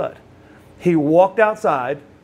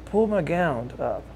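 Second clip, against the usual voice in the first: confidential request; 5 dB quieter than usual. Retold or imitated. Paralyzed friend's confidential request, first take.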